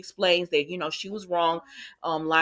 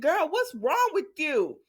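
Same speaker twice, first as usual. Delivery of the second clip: incredulous scolding; 8 semitones higher, slower than usual. Real, not acted. Host scolds the character in disbelief.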